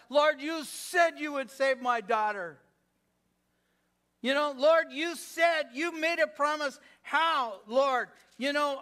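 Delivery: loud, high, impassioned acted prayer; demanding plea to the Lord, pushed high and loud; flinging promises back. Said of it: "fling 'em back in his face".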